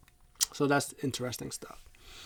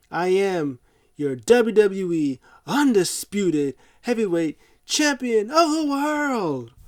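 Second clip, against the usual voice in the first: slow, loud, higher announcer voice; mock ring announcement; 6 semitones higher, 6 dB louder, much slower than usual. Brock grabbing the mic to announce himself, slow grand mock announcement. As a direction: stretch every word like a pompous ring announcer. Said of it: "he takes the mic".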